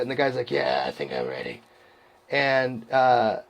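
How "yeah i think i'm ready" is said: very low, soft croak of a voice; faint, bottomed-out rasp, putting on voicelessness; imitating lost voice. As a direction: mimic a runner whose voice is gone. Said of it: "he just loses his voice".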